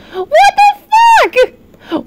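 very high, drawn-out squeal of disbelief; shocked wail. Real, not acted. Streamer reacting to Ryu, shocked high wail. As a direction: stretch it out at the top of your range.

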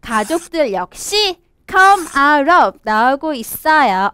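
usual speaking voice

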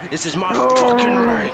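angry yell. Acted. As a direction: roar him out of your ring. Player yelling at rival wrestler.

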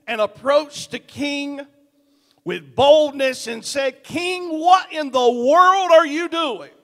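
loud, high, ringing pulpit delivery with hammered words; full-force preaching. Acted. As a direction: preach it to the back row, building and pounding. Preaching the sermon body.

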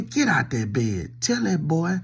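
ordinary talk, nothing marked

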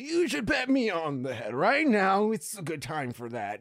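put-on slow, high old lady voice; man doing granny voice. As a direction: coax like a bossy old lady. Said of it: "Is that the old lady voice that you do?".

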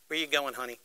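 a hint of New York ticket agent; New York accent. Amtrak woman questioning a traveler, mild New York accent. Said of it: "in her finest New York accent".

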